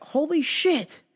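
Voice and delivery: high, excited realization; sudden awed realization; amazed. Retold or imitated. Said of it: "I went".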